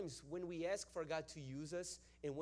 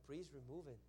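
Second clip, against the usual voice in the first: muted echo; 2 semitones lower, 11 dB quieter than usual. Retold or imitated. Preacher echoing the kid's plea, muted echo. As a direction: repeat the plea quietly, without the scream.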